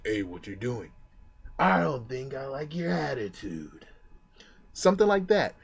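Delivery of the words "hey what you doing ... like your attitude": a hint of a tough guy sizing someone up. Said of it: "just be a tough guy saying".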